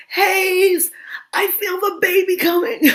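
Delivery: high, loud cry of a woman in labor; urgent mother's call. Retold or imitated. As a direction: call out that the baby is coming.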